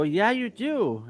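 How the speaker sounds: high, drawn-out mock contradiction; singsong comeback in a retold quarrel; teasing retort. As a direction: stretch it out slowly and high, teasing. Retelling his teasing reply.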